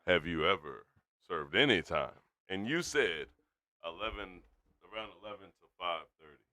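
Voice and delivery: low, measured, with pauses between phrases; deliberate recap. Real, not acted. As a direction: repeat it carefully, pitched well down. Repeats it after too-fast complaint.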